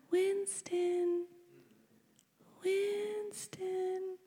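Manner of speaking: soft, high, drawn-out cooing; hushed, stretched-out motherly call; soothing call. Retold or imitated. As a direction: coo the name to a crying newborn. Mother soothing crying newborn.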